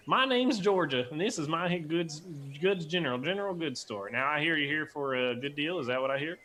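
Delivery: a folksy Southern shopkeeper; Southern-accented dwarf Georgia. Acted. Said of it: "if I do a yeehaw".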